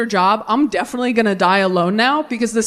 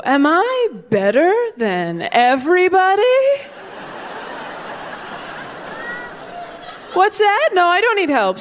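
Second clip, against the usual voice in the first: higher, breezy self-satisfied lilt; mock superior shopper; smug inner voice; 9 semitones higher than usual. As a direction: float above everyone, smug.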